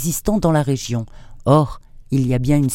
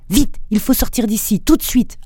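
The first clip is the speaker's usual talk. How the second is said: barked order; 9 semitones higher than usual. A narrator's reading. Marcas barks at the others to get out of the dark cellar, urgent high shout. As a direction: snap the order out like a cop in danger. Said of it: "aboya Marcas".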